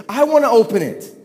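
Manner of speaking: put-on a four-year-old who won't back down; demanding toddler. Four-year-old insisting loudly.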